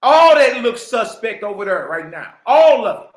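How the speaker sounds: loud, pointed declaration; loud call-out. Real, not acted. Calling out a friend's suspect dealings, loud. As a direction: call it out so the room hears.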